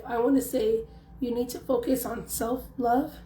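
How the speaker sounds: ordinary talk, nothing marked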